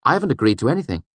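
high, quick, chirpy voice; bright. A narrator's reading. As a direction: chime in brightly and fast. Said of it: "Bast said brightly".

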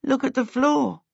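mildly insistent protest; argumentative. A narrator's reading. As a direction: argue it as proof in your favour. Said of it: "he argued".

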